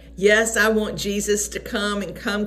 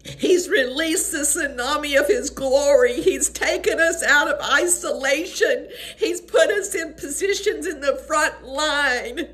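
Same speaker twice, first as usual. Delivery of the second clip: higher, tearful preaching voice; overwhelmed with emotion; 7 semitones higher than usual. Real, not acted. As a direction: testify through real tears, urgent and fervent. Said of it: "I just get overwhelmed".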